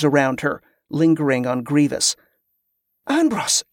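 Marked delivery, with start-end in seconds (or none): 3.1-3.6 high, urgent hiss. A narrator's reading. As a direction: hiss the name to get his attention. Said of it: "Ali hissed".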